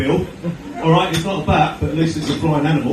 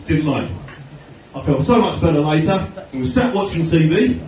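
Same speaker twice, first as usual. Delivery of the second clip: usual speaking voice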